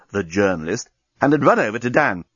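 plain reading voice

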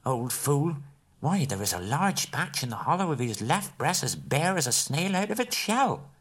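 Bilbo thinking. A narrator's reading. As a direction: mutter a private jibe. Bilbo's inner thought.

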